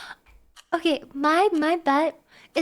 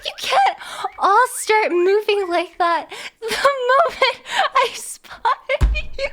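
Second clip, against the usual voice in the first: high, broken up by laughter; helpless laughing complaint; 7 semitones higher, 3 dB louder than usual. Real, not acted. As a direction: complain while unable to stop laughing. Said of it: "I'm laughing too hard".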